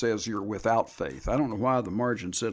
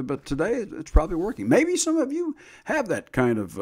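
normal talking voice

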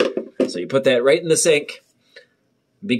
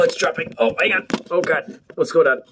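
ordinary talk

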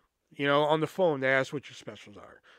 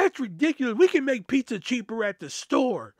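high, loud mock indignation; losing her mind; 9 semitones higher, 5 dB louder than usual. Customer scoffing at the store's pizza price, loud indignant rant. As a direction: imitate an outraged customer ranting in a high voice. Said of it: "This woman lost her fucking mind".

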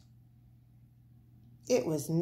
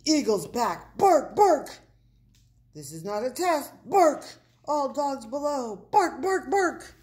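loud, high watchdog barks between warnings; barking alarm; 5 semitones higher, 6 dB louder than usual. A narrator's reading. Big Bark warns all dogs below of eagle, barking alarm. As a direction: bark it like an emergency broadcast.

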